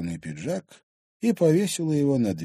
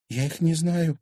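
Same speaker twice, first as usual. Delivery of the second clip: higher-pitched anxious denial; agitated; 8 semitones higher than usual. Acted. Girl denying she knows intruders, agitated. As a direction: deny it with mild worry. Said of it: "взволнованно произнесла Лиза".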